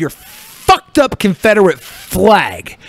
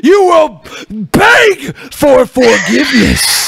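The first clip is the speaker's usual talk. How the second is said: higher, louder, building trash-talk roar, slowing on the last line; wrestling promo shout; 7 semitones higher, 4 dB louder than usual. Acted. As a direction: scream the promo into the camera. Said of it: "you just cut a promo".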